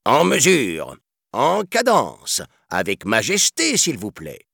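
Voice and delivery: high, projected drill-leader voice; marching commander. A narrator's reading. Elephant colonel urging his troop on, commanding. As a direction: call the marching beat, drawing out the cadence. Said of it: "guidait et encourageait sa troupe".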